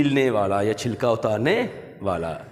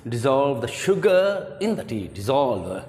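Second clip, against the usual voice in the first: slow teaching read; much slower than usual. Shown as a demonstration. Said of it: "कह सकते हैं".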